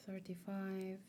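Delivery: voiceless whispered aside; soft whispered self-correction. Real, not acted. Muttered correction to herself.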